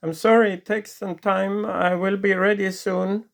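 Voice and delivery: wife calling from the kitchen; wife's voice. Acted. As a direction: sound eager to please. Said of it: "from the kitchen comes a voice".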